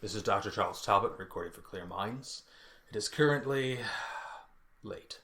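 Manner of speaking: low, soft log-entry impression; Talbot. Shown as a demonstration. Doctor Talbot recording for Clear Minds, low hushed dictation. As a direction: trail down into a near-whispered last word. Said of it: "now start with Talbot".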